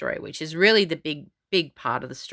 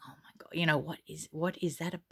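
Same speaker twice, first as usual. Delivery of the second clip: whispering; 10 dB quieter, faster than usual. Retold or imitated. Overheard diners whispering.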